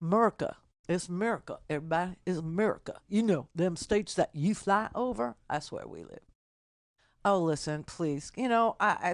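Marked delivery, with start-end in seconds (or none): 0.0-6.2 softer, put-on country twang. Host showing a colleague how to say America, country voice. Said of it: "do my country voice again".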